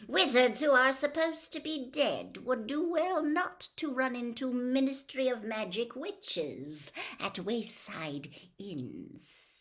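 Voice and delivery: lightly voiced cold, commanding lord; calm menace handing out orders; a dark lord instructing his servant; cold master. A narrator's reading. The cold lord explains the killing.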